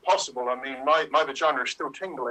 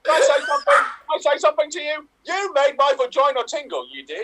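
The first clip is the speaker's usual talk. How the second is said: excited shouting; 9 semitones higher, 5 dB louder than usual. Acted. Improv scene, laughing shouting.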